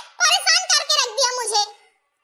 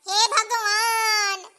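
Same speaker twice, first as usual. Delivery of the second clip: long drawn-out, slow groan of dismay; acted exasperated wail, stretched out; much slower than usual. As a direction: drag the words out in despair at mum. Exasperated on hearing soap was binned.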